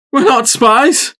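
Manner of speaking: high, loud protest. A narrator's reading. Lead buck protesting to the armored ranger. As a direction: cry out against the charge. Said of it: "the small band protested".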